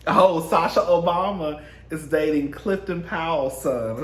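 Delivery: ordinary delivery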